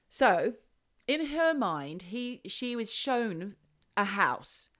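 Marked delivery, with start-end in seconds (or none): none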